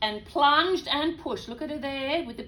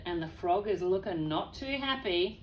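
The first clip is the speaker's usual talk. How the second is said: plain reading voice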